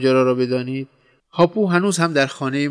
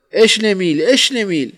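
high, loud call with falling tune; shouted call; 8 semitones higher, 7 dB louder than usual. A narrator's reading. Someone in a taxi shouting to a friend, high shouted call. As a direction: call the name out like hailing someone. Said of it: "به یکی داد زد".

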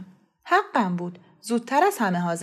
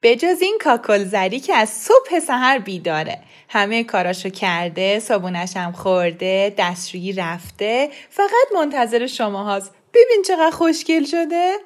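fond grandma voice; 5 dB louder than usual. A narrator's reading. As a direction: show off the little boy lovingly. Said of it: "نگاهی خندان به من کرد و گفت". (مادربزرگی مهربان و با افتخار، کمی بلندتر)

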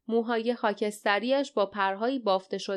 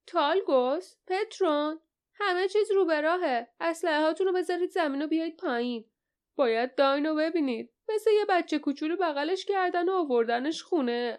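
calling out; 7 semitones higher than usual. A narrator's reading. Small man turning to tell Talgus and Petron all is well, calling out. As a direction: call up to companions in a raised pitch. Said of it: "سرش را چرخاند و فریاد زد".

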